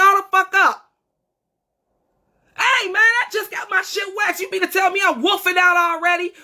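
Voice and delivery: hammed-up alarm, shouting at her own reflection; mock shocked yell. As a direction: yell it like you just caught the stubble in the mirror. Shocked at regrown mustache in mirror.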